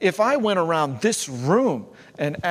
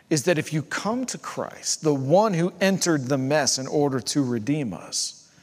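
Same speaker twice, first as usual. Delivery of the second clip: usual speaking voice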